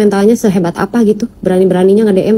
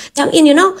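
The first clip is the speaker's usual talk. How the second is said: high, sneering mock of the troll's slang; mocking quote; 7 semitones higher than usual. Vlogger mimicking a troll's trendy slang with a high mocking lilt. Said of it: "pakai bahasa jam in".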